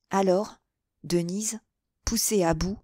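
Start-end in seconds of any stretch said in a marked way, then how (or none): none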